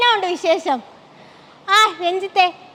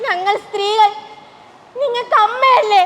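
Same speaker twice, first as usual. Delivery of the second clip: usual speaking voice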